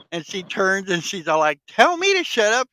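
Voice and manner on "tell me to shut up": pitched up, daring menace; high mock old lady threat. Old lady in the movie daring the intruder, high mock threat. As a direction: dare him like a tiny armed granny.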